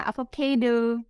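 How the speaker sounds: "Avocado" drawn out slowly in careful English; slow, exaggerated "A-vo-ca-do", the way a kids' show says it; imitating Blipi's slow English "Avocado". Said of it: "Kayak Blipi gitu".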